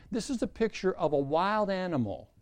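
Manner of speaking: ordinary talk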